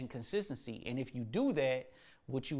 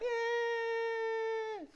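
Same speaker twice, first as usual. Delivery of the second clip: put-on high, drawn-out baby wail; imitated baby cry. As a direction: mimic a baby's wordless high cry. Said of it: "You're not talking like that. You're like".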